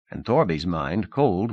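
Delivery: usual speaking voice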